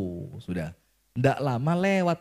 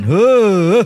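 loud, drawn-out growl of frustration; exasperated growling huff; 4 semitones higher, 11 dB louder than usual. Acted. Preacher imagining payback on the attendant, loud exasperated huff. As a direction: let pent-up irritation burst out. Mimes pent-up anger at attendant.

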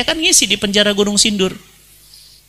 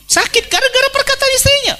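high emphatic; faster than usual. Acted. Preacher stresses husband's hurt.

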